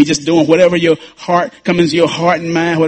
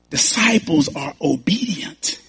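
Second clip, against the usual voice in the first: softer, slow, rising on disciples; quieter repeat; 7 dB quieter, slower than usual. Shown as a demonstration. Pastor repeats the point to the church, softer and slower. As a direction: let it sink in, said softly and slowly.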